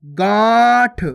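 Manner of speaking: गांठ very slow, long nasal vowel; nasalised vowel. Shown as a demonstration. Teacher correcting learners, drawn-out nasalised गांठ. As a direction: stretch गांठ through nose and mouth. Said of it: "गांठ नहीं".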